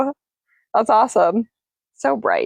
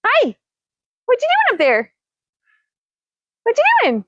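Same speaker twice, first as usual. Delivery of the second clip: much higher, cooing questions to a bird; pet talk; 12 semitones higher than usual. Real, not acted. Talking up to parrot.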